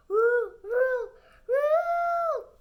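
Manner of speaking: high, loud howling whirl; loud roaring whirl. Acted. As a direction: howl it like a roaring storm. Noise growing louder, roaring blender.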